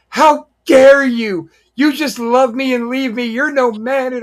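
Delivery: high, loud mock-outraged woman's voice; indignant woman. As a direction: play the jilted lady in a huff. Mildred Burke voiced in story.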